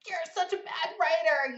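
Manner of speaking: mean inner critic. Retold or imitated.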